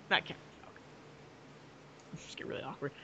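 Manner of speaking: breathy whispered protest. Acted. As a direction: whisper it in mock horror.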